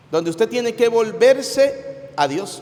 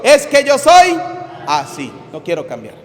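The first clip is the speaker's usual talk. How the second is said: stubborn shout of es que yo soy, much louder and higher; said hard; 5 semitones higher, 5 dB louder than usual. Shown as a demonstration. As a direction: bark it out defiantly, then drop. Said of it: "dígalo duro".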